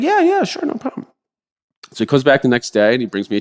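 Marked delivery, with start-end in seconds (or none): 0.0-1.1 mock breezy agreement. Imitating the adviser agreeing.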